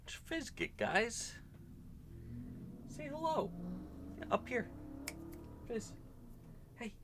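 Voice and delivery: very high coaxing voice; pet talk. Real, not acted. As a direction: coax the pet up, high and bright.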